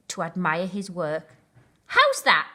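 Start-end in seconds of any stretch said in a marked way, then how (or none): 1.9-2.5 high, loud proud question. A narrator's reading. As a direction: show off the work proudly. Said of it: "he asked Rhino with pride".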